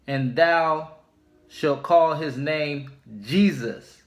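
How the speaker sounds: slow, measured Bible reading with each line weighed; solemn scripture reading. Acted. Preacher reading Matthew to his viewers, slow and solemn. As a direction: read the verse slowly and deliberately, like from the pulpit. Reads scripture verse aloud.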